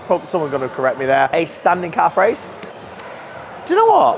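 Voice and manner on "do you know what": excited. Real, not acted. Sudden exclamation at machine.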